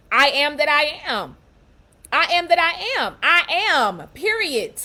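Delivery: I am that I am, spoken loud and proud; a bold affirmation of self; proud declaration. Shown as a demonstration. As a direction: declare it with full confidence. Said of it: "You like, first of all".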